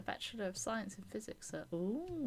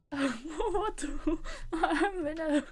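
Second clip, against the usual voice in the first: laughing; 6 semitones higher, 8 dB louder, much slower than usual. Real, not acted. Laughing at reading dilemma.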